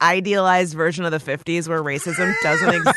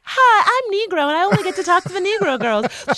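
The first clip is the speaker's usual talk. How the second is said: mock chirpy greeting; 9 semitones higher, faster than usual. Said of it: "you wouldn't be like".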